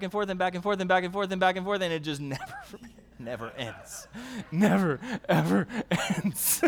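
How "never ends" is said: ordinary delivery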